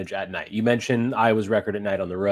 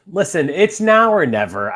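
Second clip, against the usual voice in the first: slower, louder and higher, pressing each word; emphatic plea; 6 semitones higher, 7 dB louder, slower than usual. Real, not acted. Fan pressing his case to the host, slow emphatic plea. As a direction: lean in hard to make the point. Fan pleading before rivalry game.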